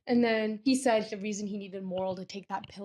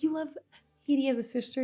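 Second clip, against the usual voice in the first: high-pitched delighted question; blije verrassing; 5 semitones higher, faster than usual. Retold or imitated. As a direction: act out happy disbelief. Dantes delighted by Valentine's news.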